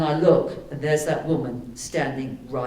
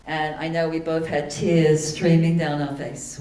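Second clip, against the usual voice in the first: ordinary talk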